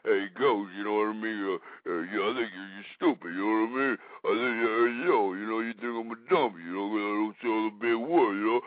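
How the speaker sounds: very low, soft dopey mumble; bass-voiced macho guy. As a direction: drop way down into a dumb tough-guy voice. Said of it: "talk with a little bit more bass in their voice".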